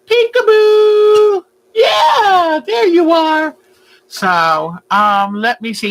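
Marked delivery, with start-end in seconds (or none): 0.1-3.6 high, loud, drawn-out peekaboo; squealed peekaboo to a pet; excited peekaboo. Acted. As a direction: burst out delighted at the reveal. Peekaboo game with bird.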